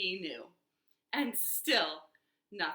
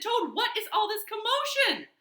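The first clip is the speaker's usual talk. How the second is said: high-pitched, astonished demand; alarmed questioning; 12 semitones higher, 4 dB louder than usual. A narrator's reading. Frog running up to shouting Toad, alarmed questioning. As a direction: ask it high, taken aback.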